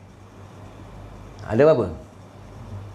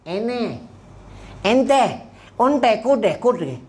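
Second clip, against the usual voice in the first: mock Arabic babble, higher; 4 semitones higher, much slower than usual. Preacher mocking the ustaz who speaks Arabic at home, mock Arabic. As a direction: rattle off fake Arabic words.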